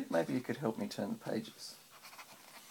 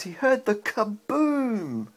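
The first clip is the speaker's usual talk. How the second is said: higher and slow, building to the kaboom; dramatic storybook boom; 8 semitones higher, 11 dB louder, slower than usual. A narrator's reading. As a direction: make the kaboom a big, slow reveal.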